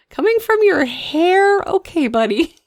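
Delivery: high, slow, laughing mock disbelief; teasing disbelief. Mother teasing her son about tears from his hair, laughing disbelief. Mocking son's excuse, laughing.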